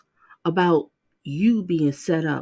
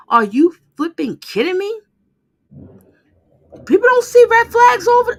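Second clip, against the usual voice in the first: rising, much louder, higher outraged rant; real anger; 10 semitones higher, 7 dB louder than usual. Real, not acted. Viewer yelling in outrage at the defendant on screen. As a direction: let the fury climb into a near-cry. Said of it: "Oh, I'm so angry".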